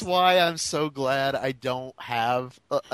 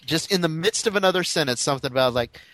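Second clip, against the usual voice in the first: normal talking voice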